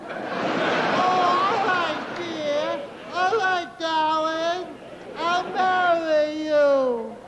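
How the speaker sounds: mock swooning bride-to-be; a hint of a coy sweetheart. Sweetheart voiced in retold film.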